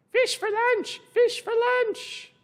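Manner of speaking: parrot voice. Acted. Parrot squawking about lunch, very high squawk. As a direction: screech it like an excited parrot. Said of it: "different sounds in the character".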